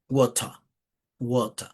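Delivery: British "water", long open O, no final R; British pronunciation. Shown as a demonstration. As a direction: model "water" as British "WAW-tah". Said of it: "en inglés británico".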